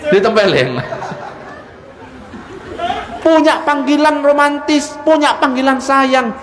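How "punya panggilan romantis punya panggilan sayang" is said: pitched up high and called out to the crowd; raised exhortation. Real, not acted. Preacher urging the congregation to use pet names, raised and high.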